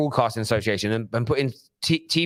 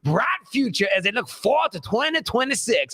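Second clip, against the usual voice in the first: put-on hammy American accent, higher; bad American accent; 8 semitones higher than usual. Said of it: "in my really bad American accent".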